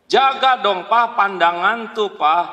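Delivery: loud, high scold; wife scolding. Pretend. Wife loudly scolding husband about his gaze. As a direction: tell him off like a wife.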